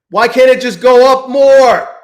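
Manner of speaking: loud, high, slow whine at the chart; real frustrated yell; exasperated shout. Real, not acted. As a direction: beg the price to rise, furious. Pleading with the chart.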